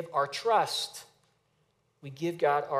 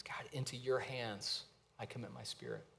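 hushed dying words; 6 semitones lower, 14 dB quieter than usual. Retold or imitated. Jesus' last words, hushed.

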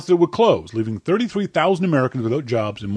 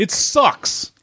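higher, stubborn "It sucks"; teasing insistence; 9 semitones higher than usual. Real, not acted. Co-host insisting to his partner, teasing. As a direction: needle her with the word sucks. Playful back-and-forth argument.

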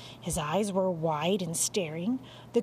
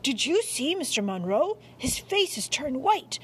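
higher, agitated exclamation; crying out; 5 semitones higher, 3 dB louder than usual. A narrator's reading. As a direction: blurt it out in alarm. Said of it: "I cried".

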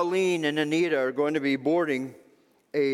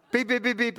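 quick, raised-pitch beeping; imitated detector beeps; 6 semitones higher, faster than usual. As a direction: mimic the alarm going off.

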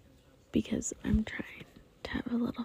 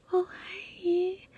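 cooing to plants; 9 semitones higher than usual. Pretend. Greeting her plants.

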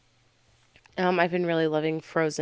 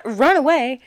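ordinary talk

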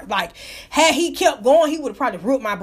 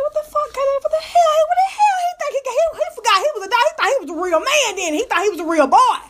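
very high, squealing mock disbelief; incredulous outburst; 11 semitones higher than usual. Reacting to a dog who thought he was human, incredulous. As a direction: shoot way up high, then ease down.

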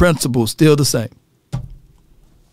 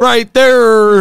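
higher-pitched, drawn-out East Saint Louis "thurr"; East Saint Louis accent; 5 semitones higher, 3 dB louder than usual. Retold or imitated. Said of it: "how y'all say there".

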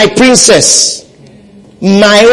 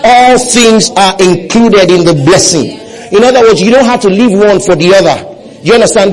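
usual speaking voice

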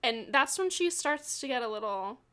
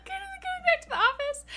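very high, giddy squeal; high-pitched fangirl glee; gleeful squeal; faster than usual. Real, not acted. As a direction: squeal it with excitement. Excited squeal over story twist.

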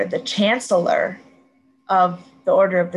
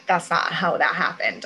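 ordinary delivery

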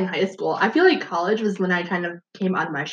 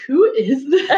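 louder, higher mock disbelief; baffled parents; 6 semitones higher, 5 dB louder, slower than usual. Daughter imitating parents reacting to her cliff jumping, mock disbelief. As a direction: voice astonished parents on the edge of laughter. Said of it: "and they're like".

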